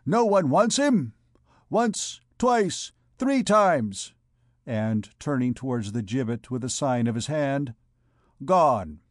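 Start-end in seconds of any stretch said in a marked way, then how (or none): 0.1-4.1 high, ringing auctioneer's count. A narrator's reading. Clopin delighting the crowd, auctioneer's count. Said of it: "imitating the accent of an auctioneer". 8.4-9.0 loud, final sale call; auctioneer. A narrator's reading. Clopin signalling towards the gibbet, auctioneer's close. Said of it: "imitating the accent of an auctioneer".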